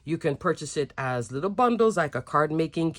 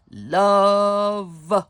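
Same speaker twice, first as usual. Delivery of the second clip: drawn-out love; 3 dB louder than usual. Real, not acted. Crafter gushing over dies.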